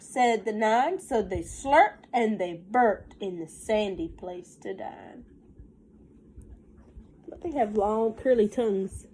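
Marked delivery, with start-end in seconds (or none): 0.0-5.2 lilting storybook read, slowed on the rhymes; reading to children. Acted. Teacher reading a counting rhyme to her class, sing-song storybook voice. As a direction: read the rhyme aloud to the little ones. Reads picture book aloud.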